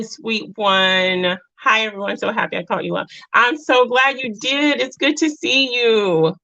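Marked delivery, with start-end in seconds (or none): none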